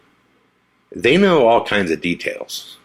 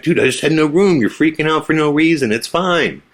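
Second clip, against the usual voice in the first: breezy friend; 8 semitones higher than usual. Retold or imitated. Friend T J shrugging off the narrator's panic, high and breezy. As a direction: toss it off like it's nothing.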